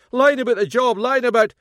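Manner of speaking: loud high raging shout; furious rage. A narrator's reading. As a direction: keep yelling at her. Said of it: "erupting in a furious rage".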